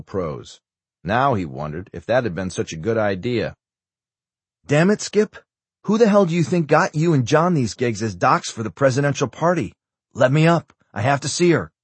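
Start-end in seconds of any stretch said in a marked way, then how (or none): 4.7-11.7 higher, heated outburst from an impatient patient; indignant, pitched-up protest; exasperated demand. A narrator's reading. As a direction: demand to be let up, voice raised. Patient angrily demands to get up.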